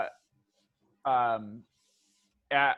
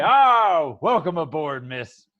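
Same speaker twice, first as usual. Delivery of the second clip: jolly crew greeting; 8 dB louder, slower than usual. Acted. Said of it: "And they say".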